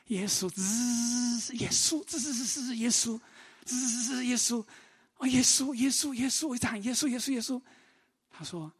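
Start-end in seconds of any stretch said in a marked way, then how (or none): 0.1-7.6 high, strained whimpers of Jesus through tooth pain; crying out in pain. Retold or imitated. Patient in dentist chair calling Jesus, high pained whimpering. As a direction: hiss through the teeth between cries of 耶稣. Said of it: "心中一直在喊‘耶稣".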